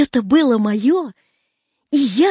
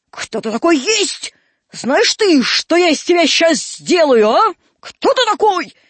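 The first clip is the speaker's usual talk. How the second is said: raised, high menacing questioning; angry interrogation; 6 semitones higher, 4 dB louder than usual. Acted. Old man grills caught Драп, angry interrogation. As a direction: demand answers from a cornered boy.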